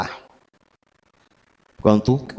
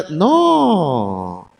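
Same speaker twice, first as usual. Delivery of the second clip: ordinary delivery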